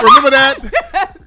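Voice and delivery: high laughing question, slowly drawn out. Real, not acted. Host laughing to cohosts about the Naughty by Nature beef, laughing. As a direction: laugh through the question, barely holding it together.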